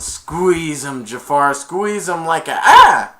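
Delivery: loud urging that ends in a high cry; parrot urging. Retold or imitated. Iago urging Jafar to crush Aladdin, loud then yelping. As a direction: egg him on, then get hit.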